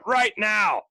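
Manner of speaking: punched salesman shout; hard-sell emphasis on both words; over-the-top sales pitch. Shown as a demonstration. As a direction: hit it like an urgent TV offer. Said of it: "really go over the top".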